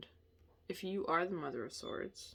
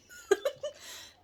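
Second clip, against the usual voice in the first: high squeaky laugh. Real, not acted. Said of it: "I'm not laughing at you".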